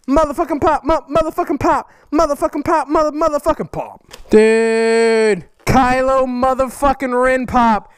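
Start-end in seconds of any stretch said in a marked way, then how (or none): none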